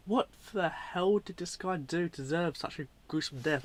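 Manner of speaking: ordinary talk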